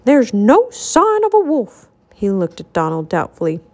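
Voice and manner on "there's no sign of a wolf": slow, high, cross complaint; cross and emphatic. A narrator's reading. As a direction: say it crossly, drawing out the words. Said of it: "he sounded cross".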